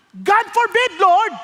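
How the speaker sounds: loud, high cry of protest; shouted rebuke. Retold or imitated. Peter shouting at Jesus in protest, loud. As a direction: shout it out in alarm. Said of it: "sinigawan naman".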